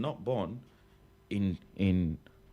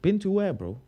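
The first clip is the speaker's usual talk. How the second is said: high, indignant been to where; comic disbelief; mock outrage; 9 semitones higher than usual. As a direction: play offended at the question.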